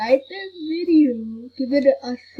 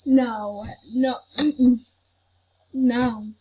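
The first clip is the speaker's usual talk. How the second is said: ordinary talk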